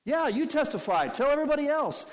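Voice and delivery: high, loud cheering on; mock encouragement. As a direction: egg him on sarcastically. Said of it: "Jesus wants him to speak up".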